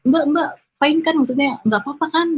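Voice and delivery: high-pitched imitation of a worried guard; concerned guard. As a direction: anxiously check she is okay, lightly acted. Penjaga memastikan ia baik-baik saja. (menirukan penjaga cemas, suara meninggi)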